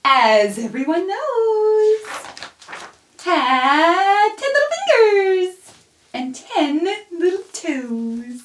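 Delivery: singsong, high, loud rhyme reading; reading to children. Acted. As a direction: read it playfully to a baby. Reads a rhyme to a baby.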